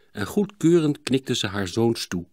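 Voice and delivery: ordinary delivery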